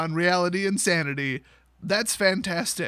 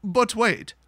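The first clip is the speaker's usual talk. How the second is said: ordinary talk, nothing marked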